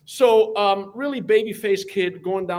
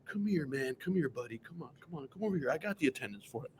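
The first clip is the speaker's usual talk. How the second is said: soft, calming coaxing; reassuring teacher; 9 dB quieter than usual. Retold or imitated. Teacher calming the seniors and coaxing the freshman in, soft and reassuring. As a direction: gently wave the kid in. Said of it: "You're like".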